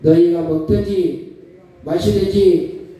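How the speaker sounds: loud, slow phrase-by-phrase recitation; call-and-repeat reading. Shown as a demonstration. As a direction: lead the congregation line by line. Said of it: "따라합니다".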